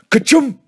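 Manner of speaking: high, loud play-acted sneeze; mock sneeze. A narrator's reading. Joe pretending to be Billy on peppered worm, mock sneeze. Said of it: "all of a sudden he'd sneeze".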